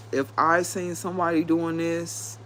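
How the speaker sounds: normal talking voice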